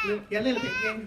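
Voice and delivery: a lightly put-on regal queen; a hint of a royal lady. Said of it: "That's the best I can do for the queen".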